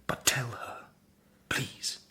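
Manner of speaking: gently insist, barely voiced; soft whisper. Walter whispering to Marian to tell Laura.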